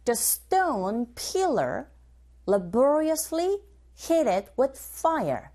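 slow, careful drill reading; teacher modelling the phrase slowly; slow model line. Shown as a demonstration. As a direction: say it slowly for students to copy. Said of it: "고고 listening three".

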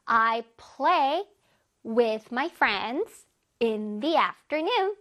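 very slow, separated words; slow model reading. Shown as a demonstration. Teacher has pupils follow the long sentence, very slowly. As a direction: take it slowly, word by word. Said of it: "자, 천천히".

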